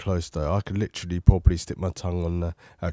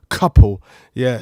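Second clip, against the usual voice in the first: ordinary talk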